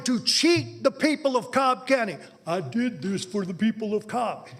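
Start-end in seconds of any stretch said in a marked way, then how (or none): 2.4-4.5 mocking imitation. Mocks the official's claim.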